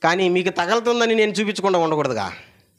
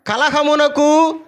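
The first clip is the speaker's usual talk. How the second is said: louder, drawn-out call with the crowd; loud and slow; 10 semitones higher, 5 dB louder, much slower than usual. Real, not acted. Congregation asked to say it louder, a slow loud call. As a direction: call the word out slowly and strongly. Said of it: "కొంచెం గట్టిగా?".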